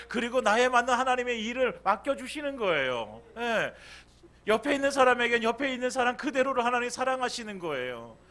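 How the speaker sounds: fervent preaching. Acted. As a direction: preach it with rising fire. Preaching at full force.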